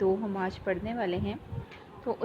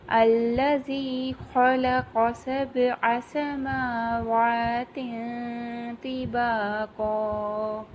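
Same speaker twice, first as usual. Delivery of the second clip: slow, drawn-out tajweed chant; measured Quranic recitation, long vowels; 2 semitones higher, 3 dB louder, much slower than usual. Shown as a demonstration. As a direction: recite slowly, stretching each vowel. Teacher recites verse.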